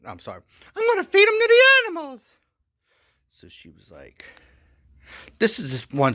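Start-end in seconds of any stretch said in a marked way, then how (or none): 0.8-2.3 very high, loud, fast mock woman's voice; gleeful villain plotting in a falsetto. Wife scheming about feeding him to the animals, in a high falsetto. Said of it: "so she's like".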